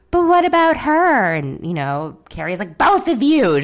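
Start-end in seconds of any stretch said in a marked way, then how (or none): 0.1-1.3 put-on high, loud childish whine; whiny eleven-year-old girl. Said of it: "like, eleven-year-old girl". 2.8-3.6 high, loud motherly scold. Retold or imitated. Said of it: "She's, like, being the mother".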